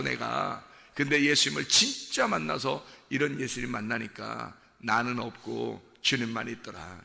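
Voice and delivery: ordinary talk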